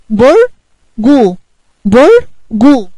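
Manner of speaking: slow drawn-out syllables برجو; syllable split. Shown as a demonstration. Teacher splitting برج into syllables for the kids, slow and loud. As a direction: split برجو into two slow beats, بر then جو. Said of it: "يبقى الباء والراء مع بعض".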